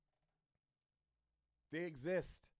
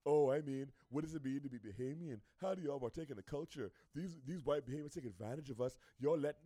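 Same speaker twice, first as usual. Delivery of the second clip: lower-pitched mocking imitation of complainers; mimicking online critics; 5 semitones lower than usual. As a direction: put on a low, halting concerned-commentator voice. Mimicking critics on social media.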